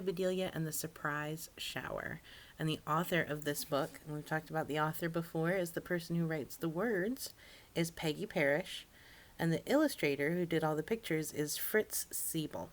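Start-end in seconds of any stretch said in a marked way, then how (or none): none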